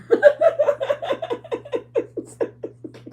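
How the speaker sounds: long, high peal of real laughter; helpless laughter. Real, not acted. Vlogger reminded of her own situation, helpless laughter. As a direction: laugh until it tails away. Cracks up over her story.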